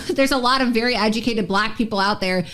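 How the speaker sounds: normal talking voice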